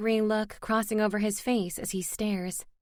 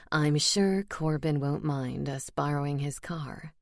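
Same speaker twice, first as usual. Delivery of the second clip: low, smug smirking male voice; 6 semitones lower than usual. Acted. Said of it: "Elias smirks".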